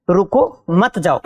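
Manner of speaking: slow रुको, short break, then मत जाओ joined; pause after रुको. Shown as a demonstration. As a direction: break the phrase right after रुको, keeping मत जाओ together. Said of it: "हमने क्या किया?".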